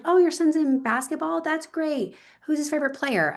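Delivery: high, gushing small talk; acted enthusiasm, pitched well up; eager interest. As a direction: perk right up with keen curiosity.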